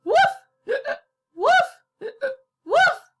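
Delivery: high, loud yelping woofs; squeaky hiccup barks. Acted. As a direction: bark like a dog with hiccups. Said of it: "hiccuped Mister Penny".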